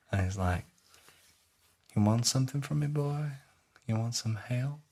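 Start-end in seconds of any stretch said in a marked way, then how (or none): 2.0-4.8 higher, softened creepy offer; creepy devil. Retold or imitated. Devil tempts the sergeant, soft and creepy. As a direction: tempt him softly, voice lifted. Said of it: "he's been really fucking creepy".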